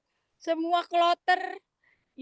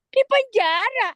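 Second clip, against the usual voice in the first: shocked; 9 semitones higher, 6 dB louder than usual. Retold or imitated. Shocked retold question.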